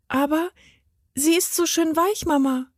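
put-on high-pitched child's voice; small girl objecting to Mama; little girl. A narrator's reading. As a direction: plead sweetly like a small daughter. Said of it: "entgegnete die Kleine".

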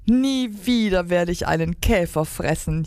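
plain reading voice

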